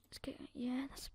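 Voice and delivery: soft whispered muttering to himself; barely voiced, to himself. Real, not acted. Gamer muttering while buying items, whispered.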